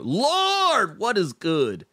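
high, drawn-out Lord; stretched high Lord of delight. Real, not acted. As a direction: cry out in happy disbelief. Reacts to grandpa news.

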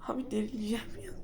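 rushed whisper of real frustration; fast exasperated whisper. Real, not acted. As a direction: whisper quickly, at wit's end.

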